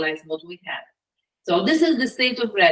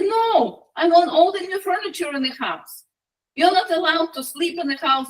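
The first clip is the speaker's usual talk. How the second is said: high-pitched indignant demand; acted bossy scolding; demanding wife; 6 semitones higher than usual. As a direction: lay down the law in a raised pitch. Wife demands furniture, banishes husband.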